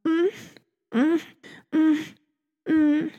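high whimpering mm sounds; small helpless whimpers. Acted. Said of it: "Scarlett was a whimpering rag doll".

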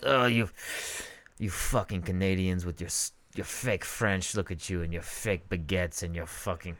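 sneering mock Frenchman; snooty French voice. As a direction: look down your nose at Canadians. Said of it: "The French are like".

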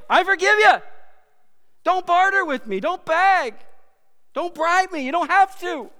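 high, loud, commanding divine voice; booming voice of God. Acted. God answering the bargaining believer, in a high booming command. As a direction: speak as God, forbidding bargaining. Voicing God's reply, booming.

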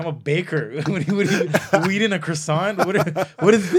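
loud, high, incredulous baker; mock outrage. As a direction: imitate an affronted baker through laughter. Retold baker outraged by the question.

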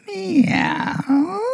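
high, drawn-out begging meow; pleading meow. Acted. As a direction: meow like a cat wanting love. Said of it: "says it in one word".

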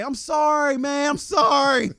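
high, pleading mock apology; high pleading imitation of the thief; thief begging. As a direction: whine it like a scared thief begging. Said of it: "he run and say".

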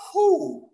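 high, loud whoop of relief; loud whew. Retold or imitated. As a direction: let out a big high whew. Imitates relief after canceling class.

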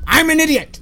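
high mock holler; calling out. Fan acts the Grinch tricking his echo, hollering. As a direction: bait the echo with a high-pitched call.

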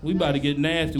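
ordinary talk

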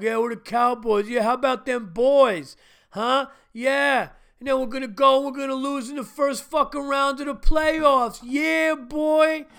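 loud, high, brash sports fan; cocky Cowboys fan. Retold or imitated. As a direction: swagger like a boastful fan.

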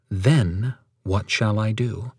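Then stressed, whole line slow. Shown as a demonstration. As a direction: lean on Then and take it slowly. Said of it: "The next emphatic word would be then".

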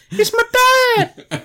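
very high squeal, breaking to a whisper; excited kid voice. Retold or imitated. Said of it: "and he goes".

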